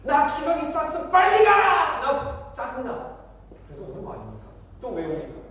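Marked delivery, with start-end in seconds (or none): 0.0-3.2 put-on high, loud, drawn-out baby wail in words; whine, then burst into a demanding yell; crying baby's tantrum. Baby demands a diaper change from parents, annoyed wailing. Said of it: "번역해 보면 이거예요".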